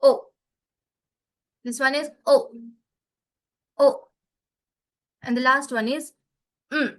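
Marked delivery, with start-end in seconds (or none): none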